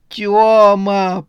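high, loud cry of relief; relieved cry. A narrator's reading. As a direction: fall on him crying his name. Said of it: "повалилась на него жена".

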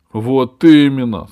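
loud, firm dragon retort; emphatic dragon. A narrator's reading. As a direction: snap back loudly. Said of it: "сказал дракон".

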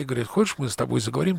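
ordinary delivery

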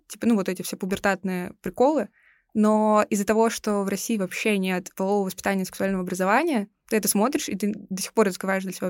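ordinary delivery

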